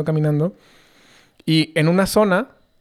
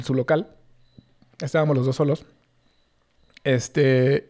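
ordinary delivery

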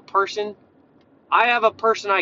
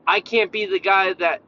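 ordinary delivery

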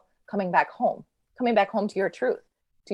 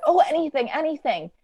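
eager; 9 semitones higher than usual. Retold or imitated. Little soul eagerly agrees in story.

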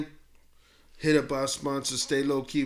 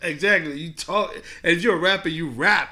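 loud excited agreement; 5 semitones higher, 8 dB louder than usual. Real, not acted.

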